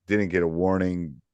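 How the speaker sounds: ordinary talk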